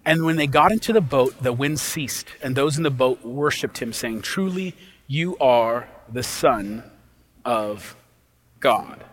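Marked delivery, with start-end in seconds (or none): 4.2-9.0 hushed awe. Acted. Disciples worship in the boat.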